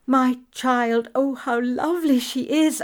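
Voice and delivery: put-on a mother seeing her child, a touch of rapture; joyful cry. Mother sees her baby after blindness, mildly crying out. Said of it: "I cried".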